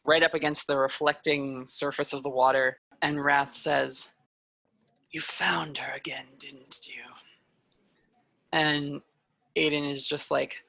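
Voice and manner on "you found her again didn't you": soft, breathy threat close to the ear; villain whispering a threat to her sister; menacing whisper. Acted. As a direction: hiss it softly as a secret. Wrath menacing Aiden close up.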